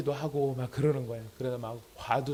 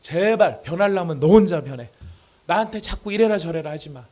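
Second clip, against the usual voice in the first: loud, irritated acted plea; exasperated rebuff; 4 semitones higher, 10 dB louder than usual. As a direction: act out fed up, telling a spouse to back off. Acted spouse rejecting the other's change. (짜증 섞어 크게 쏘아붙이는 연기)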